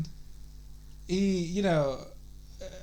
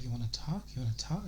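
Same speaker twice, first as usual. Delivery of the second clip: creepy whisper-like voice, softer; low creepy voice; 5 semitones lower, 9 dB quieter, faster than usual. Retold or imitated. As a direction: hush it low and creepy like a caller. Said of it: "so they're talking in a whisper".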